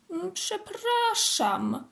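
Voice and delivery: 야옹하듯 느린 사과; slow, high kitten mew of apology. A narrator's reading. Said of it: "miałknął kotek".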